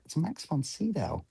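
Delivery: high, soft, slow disbelief; starstruck awe. Retold or imitated. Retelling meeting a childhood screen idol, hushed starstruck awe. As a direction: say it softly, as if pinching yourself.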